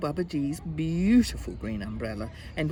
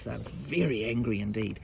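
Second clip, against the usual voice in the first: ordinary delivery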